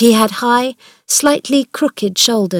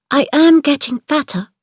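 put-on a stiff, small girl's voice, pitched higher; stiff little voice; 7 semitones higher than usual. Mary answering her uncle, in a stiff little girl's voice. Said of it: "in her stiff little voice".

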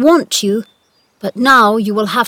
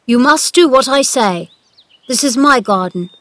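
loud, imperious instructions; commanding; 4 semitones higher, 5 dB louder than usual. A narrator's reading. Boy master giving the gardener orders, commanding. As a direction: issue orders in a raised voice. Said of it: "I'm your master".

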